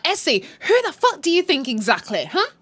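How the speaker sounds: imitated outraged rival; acted confrontation.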